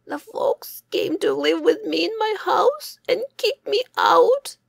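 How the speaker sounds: high, loud, sobbing hare; crying hare. Acted. Hare sobbing to the bear about his house, high and crying. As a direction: cry it out through tears.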